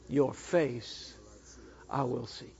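quiet, low surrender; soft devoted reply. Acted. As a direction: murmur it low and tender. Heart answering the Lord.

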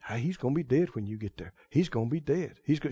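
low, very soft insinuating voice; the enemy's nagging thoughts. Acted. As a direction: plant doubt quietly under the breath. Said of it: "the one that's bringing these thoughts".

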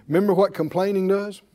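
ordinary talk, nothing marked

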